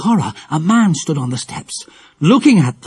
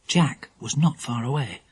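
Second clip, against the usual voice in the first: usual speaking voice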